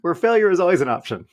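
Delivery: mock-grand show opening; podcast host intro. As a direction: open the show like a cheesy radio host. Podcast intro over music.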